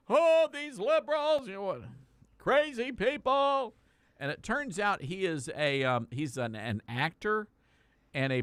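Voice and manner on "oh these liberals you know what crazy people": mock conservative. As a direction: sneer it out in a high, dragging voice. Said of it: "The conservatives are like".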